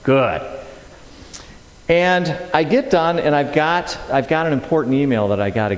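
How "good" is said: long, slow contented sigh of words; acted-out dreamy satisfaction; blissed out. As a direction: stretch it out, savoring the bliss. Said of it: "I'm just like this".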